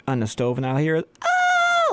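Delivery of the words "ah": high, sudden mock scream like a movie character; movie-style scream. Imitating the friend screaming at the squirrel, high mock shriek. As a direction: shriek in fake terror. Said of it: "like the dude screaming from Accepted".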